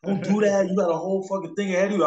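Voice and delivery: friends protesting. Retold or imitated. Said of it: "They were like".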